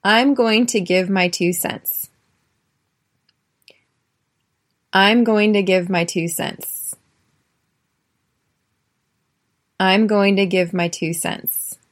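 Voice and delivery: carefully articulated teacher drill; careful pronunciation drill; clear model phrase. Shown as a demonstration. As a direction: say it clearly for students to echo. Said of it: "Repeat after me".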